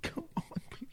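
ordinary talk, nothing marked

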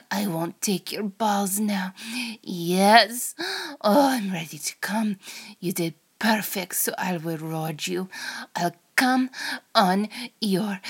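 put-on Russian accent, often half-whispered. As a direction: play a teasing, menacing Russian seductress. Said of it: "in a Russian accent".

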